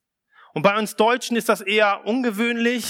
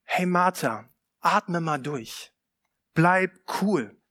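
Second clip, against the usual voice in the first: calming reassurance; 3 semitones lower, slower than usual. Retold or imitated. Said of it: "So nach dem Motto".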